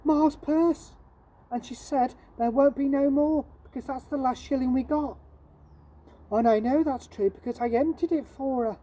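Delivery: plead, it's the last shilling; a poor boy begging the shopkeeper; Jim's voice. Acted. Jim explains to Mrs Hodder.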